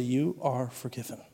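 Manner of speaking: soft, quiet and gentle; tender reassurance. Retold or imitated. God telling him he is forgiven, soft and tender. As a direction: whisper it like a comfort. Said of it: "the work of God to say".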